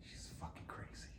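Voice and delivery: hushed, conspiratorial remark; whispered aside. Retold or imitated. Aside about his wife.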